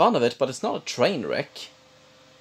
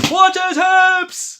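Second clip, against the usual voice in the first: high, very loud, slow yell; shouting; 12 dB louder, much slower than usual. Retold or imitated. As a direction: drag out a big football shout. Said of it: "he keeps shouting things like".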